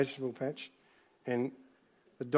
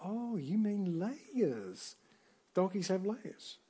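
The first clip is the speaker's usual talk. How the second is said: higher, slow, drawn-out donkey impression; 6 semitones higher, 5 dB quieter, much slower than usual. Preacher quoting Donkey answering Shrek, high and slow. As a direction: stretch every word out high like the chatty donkey. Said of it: "donkey makes the classic, classic statement".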